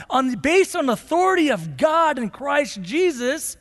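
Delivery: high, loud scripture declamation; forceful preaching. Real, not acted. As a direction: proclaim it from the pulpit with ringing force.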